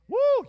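sky-high whooping shout; ecstatic whoop. Real, not acted. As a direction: let out a wild joyful woo. Preacher whoops in excitement.

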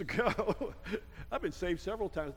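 ordinary talk

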